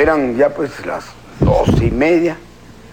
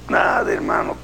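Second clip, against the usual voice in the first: pained rasp; 3 dB quieter than usual. Acted. Pasajero adolorido responde.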